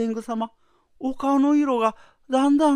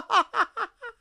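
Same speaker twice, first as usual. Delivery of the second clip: high gloating cackle; 12 semitones higher than usual. Acted. Wife gloats over his death.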